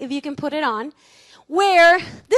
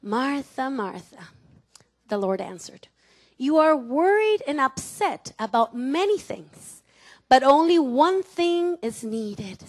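soft, low and calm; calm Jesus reassuring Martha; 3 semitones lower, 3 dB quieter than usual. Acted. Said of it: "comes very calm".

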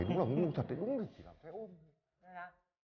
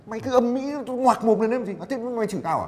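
louder, higher angry comeback; heated retort; 8 semitones higher, 10 dB louder than usual. Acted. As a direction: snap back in the quarrel. Quarrel flares up. (cãi nhau gắt gỏng)

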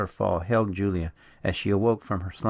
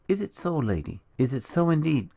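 high-pitched questioning, eagerness tinged with sorrow; wistfully eager, lifting high on each question; half eager, half sorrowful; 7 semitones higher than usual. A narrator's reading. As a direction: ask it high, torn between hope and sorrow. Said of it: "half eagerly, half sorrowfully".

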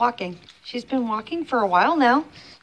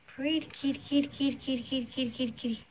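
soft murmured kitty calls; soft cooing; 4 semitones higher, 7 dB quieter, faster than usual. Real, not acted. Vlogger softly calling her kitty, soft coo.

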